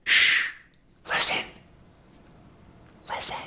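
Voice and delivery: hushed whisper. Acted. Tintin hearing a noise and hushing others, whispering. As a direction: hush them with spaced-out whispers.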